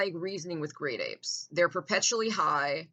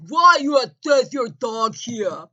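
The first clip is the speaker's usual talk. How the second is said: loud, much higher mock yell in a put-on thug voice; shouted caricature of the neighbor; mocking brutish neighbor; 7 semitones higher, 12 dB louder, slower than usual. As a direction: ham up a dumb, aggressive lout barking at you. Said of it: "I would have been like".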